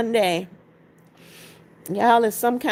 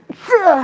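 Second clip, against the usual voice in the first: heavy loud sigh; 9 semitones higher, 7 dB louder than usual. Real, not acted. Tired after viewer's sad story.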